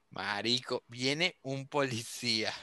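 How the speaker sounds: low, slow, softened voice; hushed warning. Retold or imitated. Warning his friends that a policeman is coming, hushed and slow.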